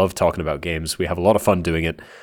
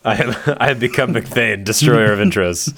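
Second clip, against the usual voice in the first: mock epic; 3 semitones higher than usual. Mock epic self-title.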